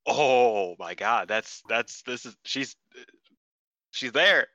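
drawn-out "oh", then halting, rising disbelief; retold worked-up awe. Fan reliving the comeback, worked up and halting. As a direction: stammer in awe, climbing by the end. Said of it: "getting, like, myself really worked up".

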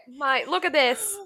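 put-on Australian accent; lightly played Australian mate; Aussie accent. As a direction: say it like an Aussie bloke. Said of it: "I'll do this in an Aussie accent".

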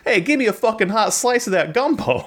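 high, hammed-up Brooklyn Italian; Brooklyn accent. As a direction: demand the gumbo like a Brooklyn guy. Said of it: "a very Italian Brooklyn guy".

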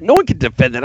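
ordinary talk, nothing marked

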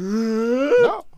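high, loud mock Chinese accent. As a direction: blurt it in a put-on Chinese accent. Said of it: "talk like a Chinese".

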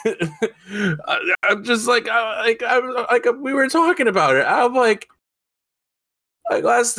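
high-pitched, real giggly talk; talking through laughter. Real, not acted. Friend cracked up by the stripper name joke, laughing.

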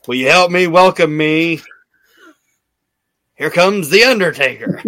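hammed-up ring announcement; mock Vince announcement. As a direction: introduce The Undertaker with a ringing flourish. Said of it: "this is what Vince does".

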